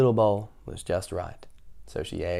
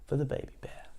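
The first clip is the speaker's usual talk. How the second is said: whisper; 3 semitones higher, 9 dB quieter, faster than usual. A narrator's reading. Tiny baby bear bowl.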